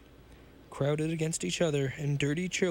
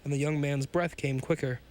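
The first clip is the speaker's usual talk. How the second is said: ordinary delivery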